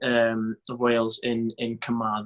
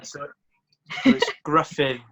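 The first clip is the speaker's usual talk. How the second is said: ordinary delivery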